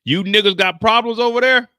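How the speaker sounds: loud mock guard shout; guard yelling. Guard yelling at inmates across the room, loud. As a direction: call out across the yard like a guard. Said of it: "The guard is looking like".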